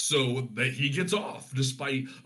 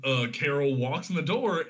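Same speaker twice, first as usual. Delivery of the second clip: normal talking voice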